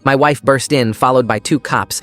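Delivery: usual speaking voice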